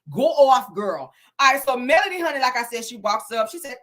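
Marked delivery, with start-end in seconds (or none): none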